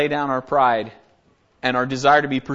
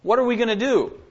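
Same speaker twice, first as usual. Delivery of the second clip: higher-pitched, lightly acted stumped councilman; 7 semitones higher than usual. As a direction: voice the stuck council, pitch lifted. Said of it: "men in the council are stuck".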